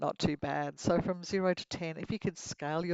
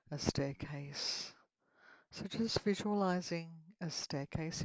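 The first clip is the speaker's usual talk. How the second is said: guided meditation; 6 dB quieter than usual. Acted. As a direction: lead them gently into relaxation.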